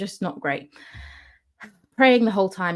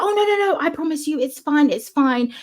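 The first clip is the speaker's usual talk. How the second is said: higher-pitched mimicked insisting; imitated eager reassurance; 8 semitones higher than usual. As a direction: brush off the worry in a high voice. Host insists the reading is harmless.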